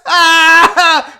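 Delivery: high, loud howl; mock laughing howl. Host imitating the guy laughing, mocking howl. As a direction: howl like the friend cracking up.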